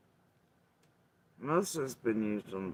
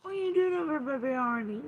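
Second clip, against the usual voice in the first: very high, sing-song pet voice; 14 semitones higher, faster than usual. Real, not acted. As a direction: coo at the little dog. Talking to pet in baby voice.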